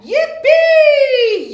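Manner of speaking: very loud, high joyful yell. Acted. Yubi yelling with delight on the sleigh. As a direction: yell it out with joy. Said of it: "yelled Yubi".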